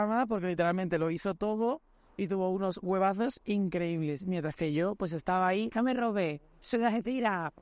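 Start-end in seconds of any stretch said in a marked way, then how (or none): 5.8-7.5 mock protest. Reprises his own shouted line.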